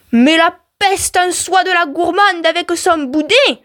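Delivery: high, slow, drawn-out scolding; indignant storybook husband; angry husband. A narrator's reading. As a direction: scold your wife in exasperation. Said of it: "je suis si en colère".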